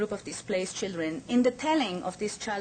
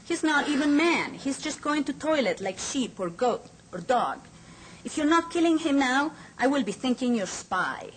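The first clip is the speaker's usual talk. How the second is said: higher-pitched, scornful commander's rant; commandant's raised, threatening harangue; commandant berating; 7 semitones higher than usual. A narrator's reading. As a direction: sneer it out as an order-giver. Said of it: "Commandant is saying".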